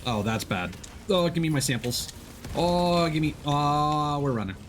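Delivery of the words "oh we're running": ordinary delivery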